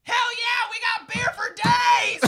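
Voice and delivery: high, loud, slow whoop; cheerful bartender yell; excited shout. Retold or imitated. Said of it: "the bartender was like".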